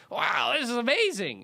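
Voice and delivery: high, loud, drawn-out mock amazement. Shown as a demonstration. Director showing an actress a sillier take, hammed-up amazement. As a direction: gush it over the top, way up high. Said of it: "just higher and just like".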